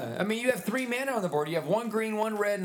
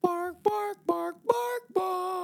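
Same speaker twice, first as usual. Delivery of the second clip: higher yappy barking; barking dog; 8 semitones higher, slower than usual. Acted. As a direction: yap like a small dog. Imitating dog.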